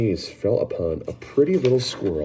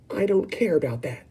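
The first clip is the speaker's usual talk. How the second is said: Veruca retorting; 9 semitones higher than usual. Acted. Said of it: "shouted Veruca".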